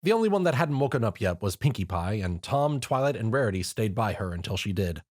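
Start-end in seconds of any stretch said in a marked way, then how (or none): none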